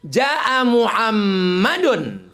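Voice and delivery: very slow, each syllable drawn out; slow demo. Shown as a demonstration. Preacher slowly demonstrating Arabic grammar to the congregation, drawn out. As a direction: spell out Muhammadan slowly for the class. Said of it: "Mana ada bahasa Inggris i'rab?".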